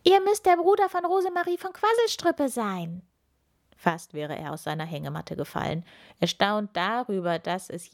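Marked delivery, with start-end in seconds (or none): 0.0-3.0 high little witch calling from afar; calling out loud. A narrator's reading. Little witch Ilvi calling to the old man from afar, high and loud. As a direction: call across the beach, high and eager. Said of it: "Schon von weitem rief sie laut".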